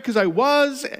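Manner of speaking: usual speaking voice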